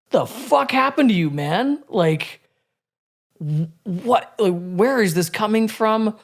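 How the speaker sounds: high-pitched, swooping disbelief; retold incredulous outcry. Silently protesting to his beloved mentor, incredulous. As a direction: act out an exasperated inner protest. Inner outcry at friend's conspiracy beliefs.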